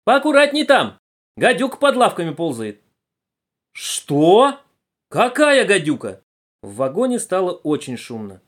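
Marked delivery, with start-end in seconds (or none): 0.0-2.8 high, loud cry of caution; shouted warning. Acted. Girl warns auntie about the loose viper, shouting. 3.7-6.2 loud, high startled question; alarmed disbelief. Acted. Passenger hears of a viper, loudly startled.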